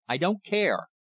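mildly desperate, pitched high; desperate cry. A narrator's reading. Accuser defying a plea to hush, mildly desperate. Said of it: "I cried in desperation".

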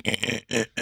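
soft broken radio static sound; breaking-up radio. Retold or imitated. Teacher's voice breaking up like radio static. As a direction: crackle like a dying radio signal. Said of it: "like radio frequency".

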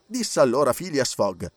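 plain reading voice

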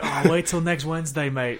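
mock British accent slipping into Australian; attempted British accent, sounding Australian. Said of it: "That's Australian".

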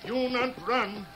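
high, firm warning call; warning shout. Acted. Tonto warning the chiefs not to run. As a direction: hold them there with your voice.

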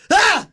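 sudden high, loud yelp of fright; startled shriek. Retold or imitated. Podcast host acting out flinching from a bee, high loud shriek. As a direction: squeal as if a bee landed on you. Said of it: "I would be like".

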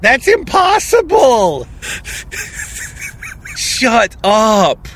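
real astonished outburst; shocked disbelief. Real, not acted. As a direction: shout your disbelief at the twist. Shocked reaction to Malloy returning.

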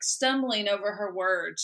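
normal talking voice

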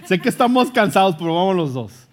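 insisting loudly through a laugh; cheerful firm push, laughter in the voice. Retold or imitated. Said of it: "Le dije".

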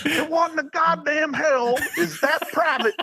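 barking drill sergeant, high and laughing; angry sergeant major. Retold or imitated. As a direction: chew him out like a furious sergeant major. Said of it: "And he says".

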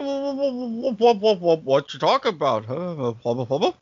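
normal talking voice

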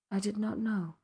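subdued, quiet admission; whispering. A narrator's reading. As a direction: say it softly, almost a whisper. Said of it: "she whispered".

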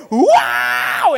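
long hammed-up scream of fright; mock scream. Visitor reacting to Freddy jumping out, mock scream. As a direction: let out an acted startled scream. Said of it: "you start screaming".

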